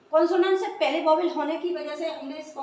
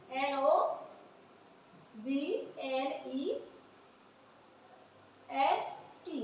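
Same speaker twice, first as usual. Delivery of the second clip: slow spelling; 9 dB quieter, much slower than usual. Shown as a demonstration. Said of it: "spelling the word slowly".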